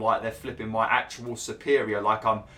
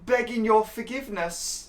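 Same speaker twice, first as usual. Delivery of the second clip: hammed-up dainty lady, higher; lofty courtly plea, higher; mock posh lady; 13 semitones higher than usual. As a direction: beg pardon like an overly humble courtier. Reading dialogue in posh voice.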